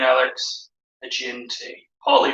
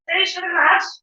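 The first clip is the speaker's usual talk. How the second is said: much higher mock granny falsetto; old lady voice. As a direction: play along as a dotty old dear.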